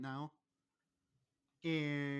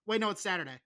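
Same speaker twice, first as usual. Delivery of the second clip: ordinary talk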